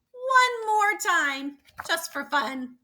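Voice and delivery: high, slow sing-song; drawn-out squeal of fun; playful high stretch. Acted. Teacher puts on goggles, playful.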